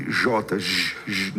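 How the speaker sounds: J as a drawn-out "zh" sound; J sound demonstrated. Shown as a demonstration. Explaining the sound Greek and Hebrew lack, J voiced as "zh". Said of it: "não tem esse som".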